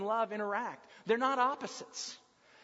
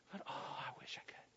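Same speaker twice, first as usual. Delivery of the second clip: breathless whispered wish; 7 semitones lower, 15 dB quieter than usual. Acted. Peter quietly confessing his longing, whispered. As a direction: whisper it like a secret hope.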